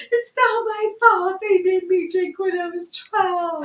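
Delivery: high, loud, drawn-out mock protest; mock whiny excuse. Guest jokingly blames Italian heritage for drinking, mock whiny. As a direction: play up a put-upon excuse, high and slow.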